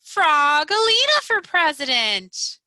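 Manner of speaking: loud rally shout. Acted. Leah on the bus cheering for Frogalina, loud shout. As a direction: cheer it out for your candidate. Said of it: "jumped up and shouted".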